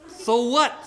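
high, defiant question; bratty retort. Acted. Cursed girl unimpressed by mommy's joy, high bratty retort. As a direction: throw it back high.

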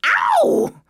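very high, loud "ow"; cartoon yelp of pain. A narrator's reading. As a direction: squeal it out. Turtle gets feather stuck in tail.

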